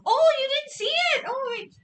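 high, loud exclamation; excited outburst. Real, not acted. Streamer realising viewers missed something, excited. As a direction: burst out high, then pull back in volume.

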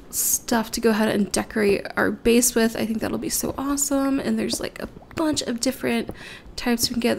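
normal talking voice

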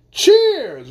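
high, loud cheers; cheerful toast. A narrator's reading. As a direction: raise a glass and shout it. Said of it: "recommended the Undersecretary of Understanding".

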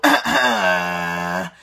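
very low rattling throat voice; deep throat voice. Shown as a demonstration. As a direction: drop to the lowest gravelly note. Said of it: "lowest point of your voice".

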